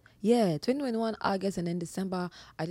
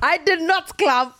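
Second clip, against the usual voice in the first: laughing through the words; giggling denial; 12 semitones higher, 12 dB louder, slower than usual. Real, not acted. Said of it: "laughing denial".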